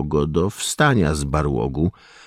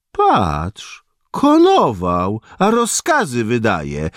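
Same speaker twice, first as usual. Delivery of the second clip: higher, louder sneering jibe; mocking goad; 7 semitones higher, 4 dB louder, slower than usual. A narrator's reading. Soldier mocking the doctor to comrades, sneering goad. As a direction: stir the others up against him. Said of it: "judził dalej ten sam żołnierz".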